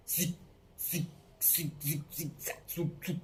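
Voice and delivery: hushed, spaced-out darting noises; whispery little zips, one per turn; zigzag motion effect. Shown as a demonstration. Teacher miming particles darting about, hushed sound effect.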